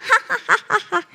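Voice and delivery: a granny's gleeful victory laugh; old woman's gleeful laugh. Acted. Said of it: "जोरजोरात हसायला लागली".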